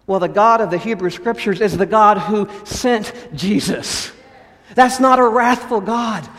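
usual speaking voice

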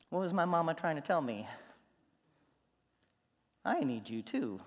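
normal talking voice

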